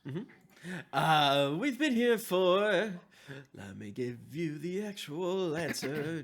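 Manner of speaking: ordinary talk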